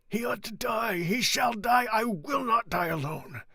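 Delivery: high, loud frantic shouting. Acted. Andrea shouting while struggling with the executioners. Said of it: "he kept exclaiming".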